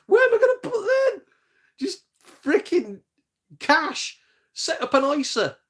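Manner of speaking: loud, very high-pitched disbelief; exasperated outburst. Acted. Heir landed with two thousand games, exasperated. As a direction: squeal it in outrage, starting on a laugh.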